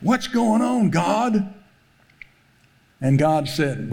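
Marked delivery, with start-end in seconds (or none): 0.0-1.5 pleading in frustration, pitch climbing; aggravated. Retold or imitated. Frustrated man crying out to God over his wrecked car, exasperated. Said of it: "he was really aggravated".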